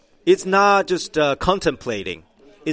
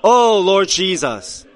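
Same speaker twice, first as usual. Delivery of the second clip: calling out to the Lord; 6 semitones higher, 8 dB louder, slower than usual. Shown as a demonstration.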